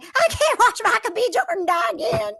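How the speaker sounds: hammed-up Southern Belle whine; mock Southern Belle. Teasing her cohost with a Southern Belle voice. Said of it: "it's Southern Belle".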